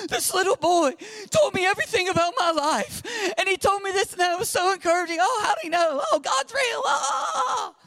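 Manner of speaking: high-pitched wailing imitation of a tearful woman; mock weeping. Older church lady testifying about the boy's prophecy, mock weeping. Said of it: "older lady in our church is just weeping".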